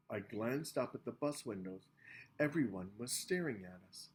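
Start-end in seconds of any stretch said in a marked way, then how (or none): none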